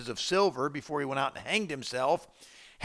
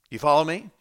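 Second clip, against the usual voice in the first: ordinary delivery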